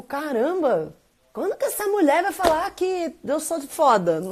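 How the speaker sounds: a touch of mock despair; exasperated inner voice. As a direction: ask it up high like a fed-up kid. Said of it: "eu interpretava assim".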